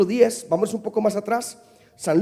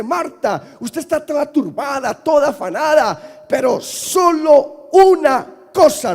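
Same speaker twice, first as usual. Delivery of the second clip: Jesus rebuking Marta; 6 semitones higher, 7 dB louder, slower than usual. Acted. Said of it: "Jesucristo le dice".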